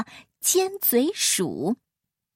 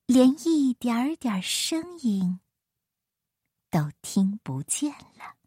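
hushed narration. A narrator's reading. Storyteller describing the silent grassland, fading to whisper. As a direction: fade down into a whisper.

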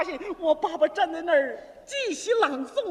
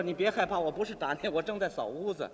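lightly voiced brother, low and softer; older brother; 9 semitones lower, 5 dB quieter than usual. Brother with a duster says don't be afraid, low. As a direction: reassure in a lowered, even voice. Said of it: "我哥哥说".

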